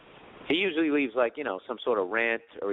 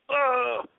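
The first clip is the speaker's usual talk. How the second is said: high strained groan; 13 semitones higher than usual. Retold or imitated.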